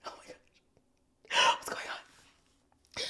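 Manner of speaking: whimpering, whispered and trembling; mock crying whisper. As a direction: fake sob under the breath. Overwhelmed by the episode.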